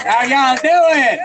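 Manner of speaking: slow, stretched call to the crowd; drawn-out crowd greeting. Real, not acted. [name] greets rally crowd, slow drawn-out call. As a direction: draw out the hello to the whole rally.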